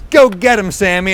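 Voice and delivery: mom's high, loud cheer. Retold or imitated. Mom cheering her son out the door, high and loud. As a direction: cheer him on like a proud mom. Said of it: "And my mom goes".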